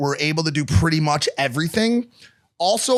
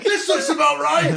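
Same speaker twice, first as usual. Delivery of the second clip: laughing mock dad; 9 semitones higher than usual. Dad's line voiced laughing.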